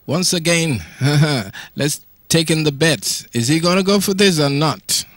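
ordinary talk

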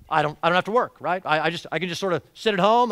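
loud, fast, mocking a lazy believer; mock lazy excuse.

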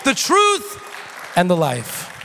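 fiery revival preaching; preaching at full force. Acted. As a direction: build to a shouting crescendo.

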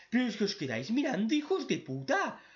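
hammed-up fed-up yell at onlookers; mock exasperated scolding. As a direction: scold useless bystanders half laughing. Scolding characters for watching.

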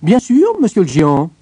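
high, loud, eager reply; obliging tailor. A narrator's reading. Tailor agreeing to help the giant, obliging. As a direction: agree readily in a high little-man voice. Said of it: "répondit le petit tailleur".

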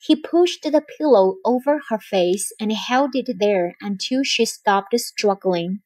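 plain reading voice

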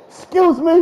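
high sing-song taunt with laughter; mocking taunt. Real, not acted. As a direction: rub it in their face. Mocking the beaten opponent.

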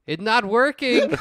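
put-on high, loud comic Mexican accent. Man failing to regain his English, helpless mock Mexican accent. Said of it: "repeat my name until it comes out English".

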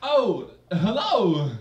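slow, drawn-out sleazy hotline greeting; lightly voiced Walken purring to a caller; Christopher Walken impression. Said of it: "my impersonation of Christopher Walken had he been working for a sexual hotline".

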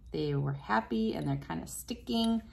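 ordinary talk

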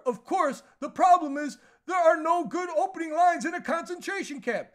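high, loud mocking comic voice; Larry David impression. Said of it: "he said this".

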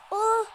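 high, loud startled oh; loud gasp. Real, not acted. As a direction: gasp out at the near miss. Near miss in game. (yüksek perdeden şaşkın nida)

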